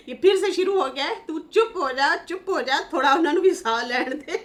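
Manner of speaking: laughing mock scolding. Laughing retelling of comment.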